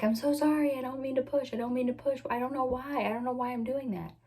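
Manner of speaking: high, anxious apology, repeated over and over; apologetic pleading. Retold or imitated. Apologizing to nurses while pushing.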